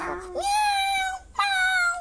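miau que sube a muy agudo; maúlla como gatito; maullido de gatito. Acted. (maullido de gatito que sube agudo)

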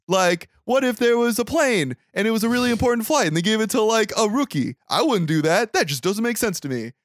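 lightly hammed-up know-it-all juror; mock juror. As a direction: play the one chatty juror making his point. Juror voiced in retold scene.

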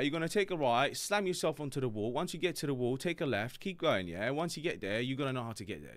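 a hint of a London accent, every phrase lilting up; English question-like intonation. Shown as a demonstration. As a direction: give directions like a Londoner, ending each bit with yeah?. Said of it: "you wanna sound like an Englishman".

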